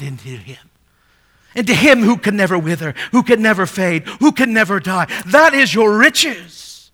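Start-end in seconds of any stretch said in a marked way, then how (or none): 1.5-6.9 louder, higher, urgent pulpit voice; preaching at full force. Acted. Preacher urging the poor congregation, louder and higher. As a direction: preach it out to the whole church. Building climax of sermon.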